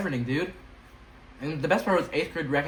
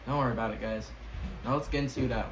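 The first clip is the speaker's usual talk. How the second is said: ordinary delivery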